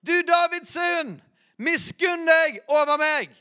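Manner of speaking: high, loud, slow cry, pushed harder still. Acted. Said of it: "står det enda høyere".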